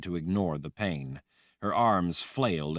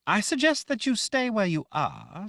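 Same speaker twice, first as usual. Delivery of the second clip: much higher, cold threat; Sister Armina voice. A narrator's reading. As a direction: warn her off, icily. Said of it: "Sister Armina said".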